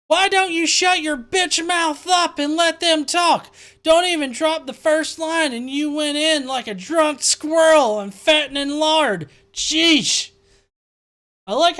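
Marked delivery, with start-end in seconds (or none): 0.1-10.3 slow, louder, higher mocking commenter voice; snarky commenter. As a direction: scold like a smug troll.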